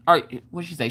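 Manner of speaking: normal talking voice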